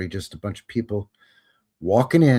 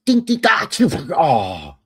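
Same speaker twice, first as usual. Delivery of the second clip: high dinks breaking into a loud fake sneeze; mock sneeze; 8 semitones higher, 7 dB louder than usual. Sculptor sneezes mid-chisel, loud hammed-up sneeze. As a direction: dink then a hammed-up sneeze, louder.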